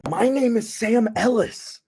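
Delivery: high put-on intro voice; announcer intro. Vlogger opening the video, high mock intro.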